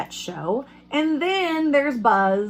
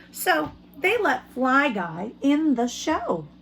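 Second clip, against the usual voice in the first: put-on reading to children; 3 semitones higher, slower than usual. Teacher reads picture book aloud.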